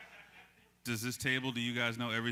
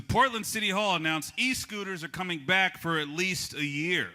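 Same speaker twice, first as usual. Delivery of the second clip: raised, projected local-news delivery; monologue news read; 5 semitones higher, 3 dB louder than usual. Acted. Late-night host reading local news for a joke, projected monologue voice. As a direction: announce the headline to the room.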